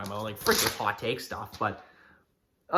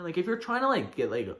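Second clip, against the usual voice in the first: ordinary talk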